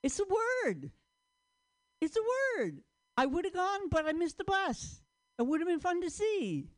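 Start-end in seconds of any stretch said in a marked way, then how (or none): 3.2-6.7 lightly higher, showing off the usage; a touch of a sing-song example voice; mildly raised example read; high demo voice. Shown as a demonstration. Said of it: "Like that".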